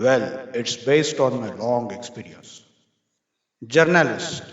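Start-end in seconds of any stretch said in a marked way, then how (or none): 0.1-2.5 low-voiced industrialist in an interview; renowned industrialist. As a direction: answer in a deep, measured executive voice. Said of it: "Industrialist responded".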